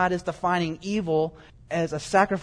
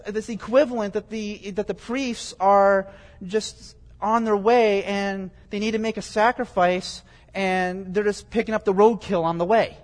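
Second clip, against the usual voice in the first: ordinary talk, nothing marked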